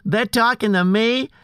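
loud, lightly put-on New York accent. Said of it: "changing your accent".

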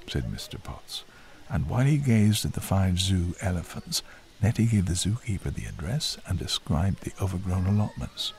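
plain reading voice

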